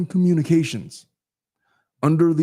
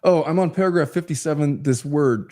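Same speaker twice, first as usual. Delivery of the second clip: ordinary talk, nothing marked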